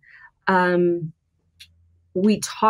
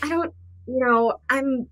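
retold hesitant approach; 6 semitones higher than usual. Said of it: "I was like".

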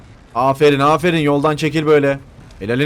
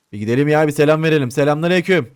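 ordinary talk, nothing marked

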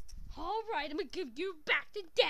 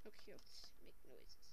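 very soft, low whisper; conspiratorial whisper; 11 semitones lower, 16 dB quieter than usual. Acted. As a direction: plot quietly so nobody hears. Toys plotting a scare in secret.

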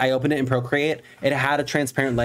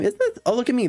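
much higher, giddy squeal at herself; excited squeal; 12 semitones higher, faster than usual. Real, not acted. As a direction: squeal with delight at seeing herself. Delighted at seeing herself.

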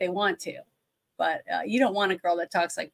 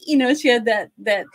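normal talking voice

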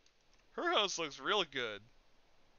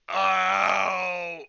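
pained shout; 3 semitones higher, 14 dB louder than usual. Acted. Character falls, shouts in pain.

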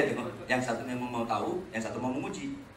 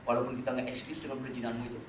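normal talking voice

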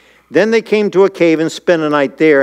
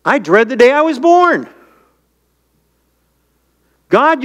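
higher, pleading cry of a despairing servant; anguished complaint to God; 8 semitones higher than usual. Retold or imitated. Servant of God complaining to God, higher pleading anguished cry. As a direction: plead up high, as if God has let you down. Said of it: "Here's a servant of God that's saying".

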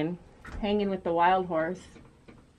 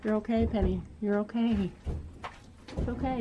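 soft soothing; 8 dB quieter than usual. Real, not acted. Soothing the horse.

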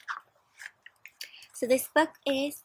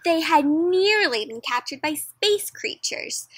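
higher, louder, slow dramatic excuse; breathless excuse; 5 semitones higher, 6 dB louder than usual. Acted. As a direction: sell the wild story to the teacher. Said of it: "they told their teacher".

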